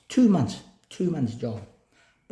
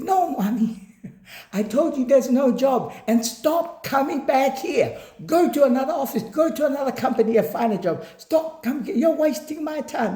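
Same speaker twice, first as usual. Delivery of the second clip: retold boss shouting; 8 semitones higher than usual. Manager telling Ami to go to another company, mock yell. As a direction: bark at her half laughing. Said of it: "He was screaming and shouting".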